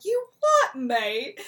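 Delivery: incredulous mock challenge; jokey offended mate; mock indignant. Duke reacting to Lucio's insult.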